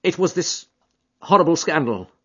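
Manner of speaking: a touch of grief; strained, barely holding together; distraught. Acted. Grieving brother explaining to Holmes, mildly distraught. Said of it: "hardly articulate".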